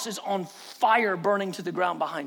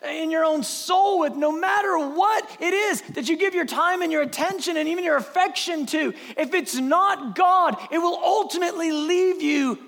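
impassioned preaching; 6 semitones higher than usual. Acted. Preacher urging listeners that only God satisfies, impassioned. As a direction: preach it with urgent conviction.

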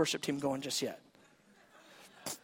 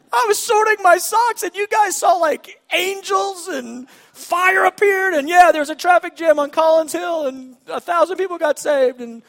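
high, loud, gushing rush of wonders; much louder and higher over-the-top account; cartoonish excitement; 8 semitones higher, 8 dB louder than usual. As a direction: ham up the amazed telling. Acted excited testimony, cartoonish.

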